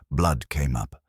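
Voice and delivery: plain reading voice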